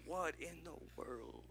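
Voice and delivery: hushed, amazed and slow; awed whisper. Acted. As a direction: say it barely aloud in wonder. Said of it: "He's like".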